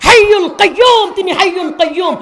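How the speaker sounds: full-force Bengali waz preaching; fiery sermon. Real, not acted. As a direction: rouse the crowd with booming verses. Fiery preaching with Quranic recitation.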